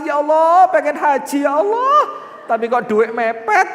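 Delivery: high, loud mock wail to God; hammed-up desperate prayer; mock pleading. Acted tearful prayer for hajj money.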